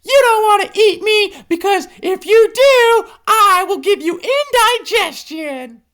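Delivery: very high, loud squeaky bluff; backing-away hippo warning the monster, high squeak; desperate hippo plea. Acted. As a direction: bluff your way out fast. Cornered hippo bargains with monster.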